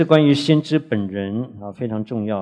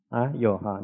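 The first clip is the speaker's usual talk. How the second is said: usual speaking voice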